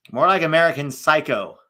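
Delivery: normal talking voice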